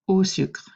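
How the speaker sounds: au sucre said in French for repetition; French pronunciation. Shown as a demonstration. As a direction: model "au sucre" clearly. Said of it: "répétez".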